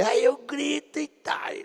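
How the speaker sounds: high, drawn-out mock mother; mãe imitada. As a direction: imitate a mother casually confessing, slow and high. Imita mãe admitindo que grita. (voz aguda imitando a mãe)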